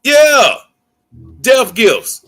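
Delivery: a mocking yeah jumping high, then Death gifts said with scorn; mock the phrase Death gifts as if it were absurd; mocking repeat. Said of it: "No one talks like that".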